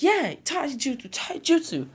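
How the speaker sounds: high-pitched gleeful cheer; excited kid. Acted. Boy cheering for taijutsu training, excited. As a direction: squeal it like a thrilled little boy.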